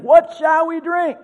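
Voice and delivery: high, loud mock whine of protest; grumbling complaint. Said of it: "grumbled against Moses".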